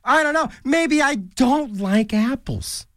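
slow, put-on sulky voice; drawn-out pout; mock pouty voice. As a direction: sulk it out slowly. Slow odd-voiced aside.

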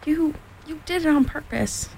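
high, stammered accusation; sputtering. A narrator's reading. Piglet accuses Kanga after a soapy mouthful, sputtering. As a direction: stumble indignantly into the words, mildly acted. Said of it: "sputtered Piglet".

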